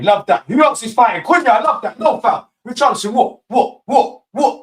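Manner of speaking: high, loud fired-up shouting; excited yelling. Real, not acted. Fan cheering on a scuffle, high excited yell. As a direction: roar with excitement at the scrap.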